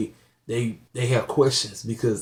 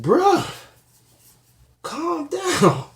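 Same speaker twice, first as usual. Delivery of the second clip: much higher, disbelieving "bruh, calm down"; exasperated plea; much slower than usual. Retold or imitated. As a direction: plead with them to calm down, pitched way up. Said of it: "I'm like".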